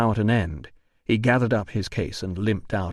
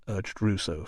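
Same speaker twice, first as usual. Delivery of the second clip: plain reading voice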